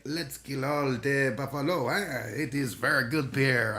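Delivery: playful Quebec French accent mockery. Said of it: "do that accent because I'm Canadian".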